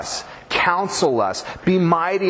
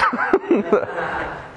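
unvoiced laugh; 7 semitones higher than usual. Real, not acted. Laughing at his own memory.

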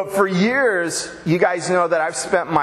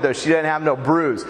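usual speaking voice

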